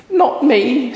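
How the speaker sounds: very high rabbit voice. Acted. Rabbit refusing to push, very high. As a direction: squeak it up high. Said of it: "says the rabbit".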